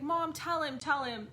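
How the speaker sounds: put-on high, loud pleading kid; urging child. Child begging mom to tell the spirit, urgent.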